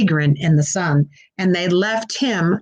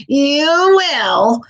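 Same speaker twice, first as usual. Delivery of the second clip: higher and louder gleeful witch; 7 semitones higher, 5 dB louder than usual. A narrator's reading. Witch gloating at the frog, higher and louder. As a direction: burst out with mocking glee. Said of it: "She was laughing up her flapping sleeve".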